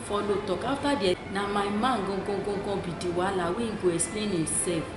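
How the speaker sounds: usual speaking voice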